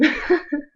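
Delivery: a short burst of laughter; real laugh. Real, not acted. Laughing after telling family about the hook. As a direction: laugh at the gross news.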